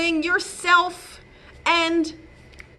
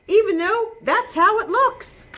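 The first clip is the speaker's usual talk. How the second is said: ordinary delivery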